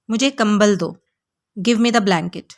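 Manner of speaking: normal talking voice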